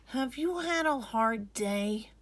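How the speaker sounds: a little brother asking with care; gentle young boy. Acted. Boy asking his tired sister gently. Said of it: "Majid asked".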